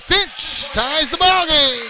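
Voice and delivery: loud, slow, rising game call; excited play call. Real, not acted. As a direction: call the tying basket big. Game-tying basket call.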